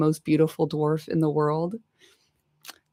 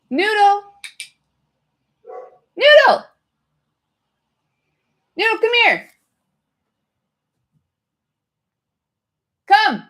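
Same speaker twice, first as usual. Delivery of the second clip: high, loud call across the house; calling the dog; 8 dB louder than usual. Real, not acted. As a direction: shout for the dog to come.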